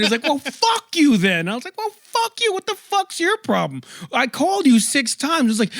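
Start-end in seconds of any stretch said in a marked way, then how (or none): 0.2-1.5 high, drawn-out angry comeback; livid retort. Retold or imitated. Livid driver swears back at passenger, mock furious. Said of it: "he's more livid". 1.8-5.5 very high-pitched outraged retort; retold squealing indignation, voice way up; indignant comeback. Passenger throws the driver's insult back, indignant.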